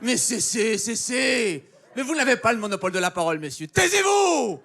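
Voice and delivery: mock a heated French debater losing it. Mocking French TV debaters, building to shrieking. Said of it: "vous êtes comme".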